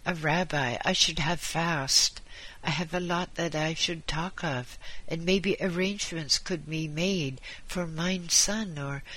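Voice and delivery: sickbed appeal with a Yiddish lilt. Acted. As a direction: plead the case as a dying husband.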